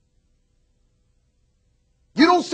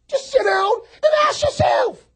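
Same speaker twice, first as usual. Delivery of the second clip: exhorting cry; 3 dB louder than usual. Real, not acted. Exhorting congregation.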